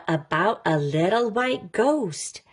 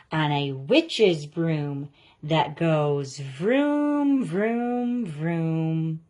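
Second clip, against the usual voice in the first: vroom vroom; slower than usual. Acted. Vroom broom sound effect.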